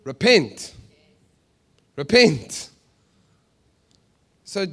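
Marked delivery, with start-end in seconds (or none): 0.0-2.7 loud, forceful one-word command; stern preacher's cry; John the Baptist. Acted. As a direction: proclaim it like a street prophet, then pause. Voicing John the Baptist's message.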